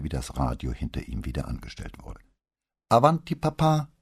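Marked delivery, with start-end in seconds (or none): 2.9-3.9 higher and louder call through a closed door. A narrator's reading. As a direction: sing out to her father to come in. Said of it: "rief sie".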